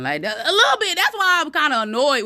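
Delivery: high, loud laughing words, a bit fast. Real, not acted. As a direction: laugh through the embarrassment. Laughing at her own crying.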